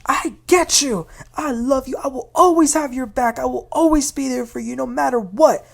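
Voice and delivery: high, intense, over-the-top devotion; mock-intense promise to a grieving friend; overly passionate. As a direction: gush it a bit too much. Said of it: "she started to sound a little crazy".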